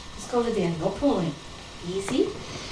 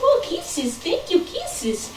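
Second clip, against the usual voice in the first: high gushing coo; cooing at the puppy; 9 semitones higher, 4 dB quieter, much slower than usual. Real, not acted. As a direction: coo thanks to the dog.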